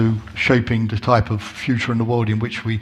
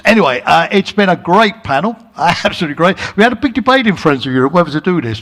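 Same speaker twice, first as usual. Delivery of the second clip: higher and louder, quick with a laugh; upbeat wrap-up; 6 semitones higher, 5 dB louder than usual. Real, not acted. Host wrapping up the panel for the audience, bright and excited. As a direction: beam through the thank-you.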